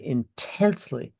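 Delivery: intensely stretched slowly with falling emphasis; slow weighty intensely; stress on intensely. Real, not acted. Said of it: "and I emphasize this word".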